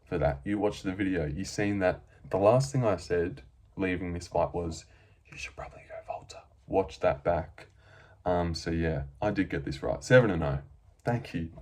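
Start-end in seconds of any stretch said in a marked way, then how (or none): 5.3-6.4 fast, secretive whispered tip; hushed quick aside. Retold or imitated. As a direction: breathe it like an insider's secret. Retelling his own tip at the end.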